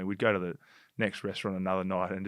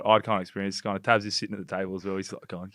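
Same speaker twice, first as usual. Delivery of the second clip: ordinary talk, nothing marked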